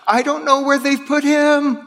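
high pleading cry, lightly acted; a preacher voicing Mary's frantic cry; frantic shout. Preacher voicing Mary at the empty tomb, mildly frantic. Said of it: "come up with this frantic shout of".

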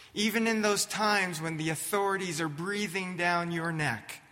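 ordinary talk, nothing marked

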